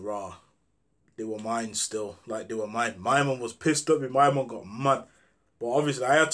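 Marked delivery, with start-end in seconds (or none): 0.0-3.0 much softer and lower admission; sheepish confession. Retold or imitated. Speaker admitting to his angry friend the bullets were his, quietly. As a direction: own up quietly, a touch guilty.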